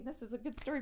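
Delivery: ordinary delivery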